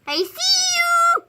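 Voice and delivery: high slow sing-song peekaboo. Acted. Peekaboo with cat.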